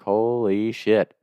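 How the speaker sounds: "Holy shit" drawn out slowly, stunned. Retold or imitated. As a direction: let "Holy shit" stretch out slowly in disbelief. Retold stunned reaction in car.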